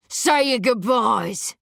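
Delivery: slow, loud menacing roar; furious roar. Acted. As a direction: bellow it at the girl. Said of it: "he roars, furious and volatile".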